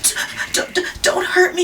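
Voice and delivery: stuttering frightened plea; whimpering. Acted. A touch of the frightened lion whimpering to the mouse. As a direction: stammer it out in fear. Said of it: "he whimpered".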